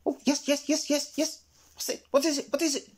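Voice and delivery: put-on high, quick, fussy old squirrel; jittery high squirrel babble; Mister Squirrel. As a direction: chatter in a high twitchy squirrel voice. Excitable old Squirrel answers.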